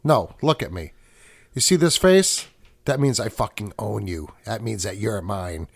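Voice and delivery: low, menacing, dropping to near murmur; a hint of a movie tough guy claiming ownership; mob enforcer quote. Quoting Get Shorty to his co-host, low menacing gangster. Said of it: "And you say this".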